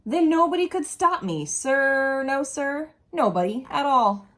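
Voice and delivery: ordinary delivery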